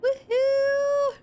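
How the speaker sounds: high, loud victory whoop; joyful cheer. Real, not acted. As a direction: cheer the level-up. Warlock reaches level eighty.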